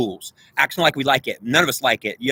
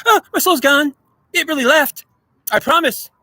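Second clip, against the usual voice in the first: much higher, louder hammed-up Pelosi voice; mocking Nancy Pelosi; 12 semitones higher, 4 dB louder than usual. Pelosi insisting to everyone her soul left, shrill mock pleading. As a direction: overplay a fake earnest vow. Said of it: "She goes around going".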